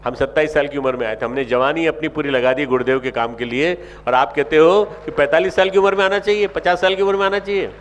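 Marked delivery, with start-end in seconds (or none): 4.1-7.7 louder, higher rebuke; fiery sermon reproach; indignant preaching. Real, not acted. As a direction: scold the crowd in disbelief. Preacher scolding the young.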